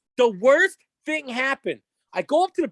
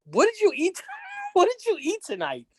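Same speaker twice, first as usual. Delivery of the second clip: higher, laughing through the question; 8 semitones higher than usual. Retold or imitated. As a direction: ask it while cracking up. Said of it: "And I'm like".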